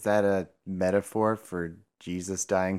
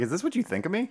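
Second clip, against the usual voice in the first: mock offended author; 11 semitones higher, 4 dB quieter, much faster than usual. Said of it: "Be like".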